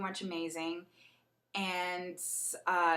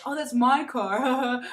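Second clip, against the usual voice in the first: slow, louder, offhand; casual as if it's nothing; mock guy voice; 5 semitones higher, 8 dB louder, slower than usual. Mark admitting the car is his, slow and offhand. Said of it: "he's like".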